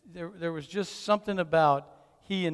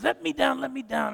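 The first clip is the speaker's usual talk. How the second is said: mildly acted high-pitched protest; high, insistent kid plea, mock; child's protest; 7 semitones higher than usual. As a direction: lightly imitate a boy wriggling free. Retold grandson wriggling out of hug.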